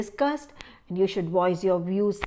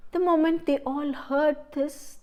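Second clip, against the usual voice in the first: usual speaking voice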